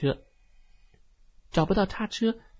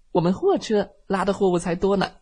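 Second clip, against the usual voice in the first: boasting; 6 semitones higher, 5 dB louder than usual. Acted. Van boasts in quarrel.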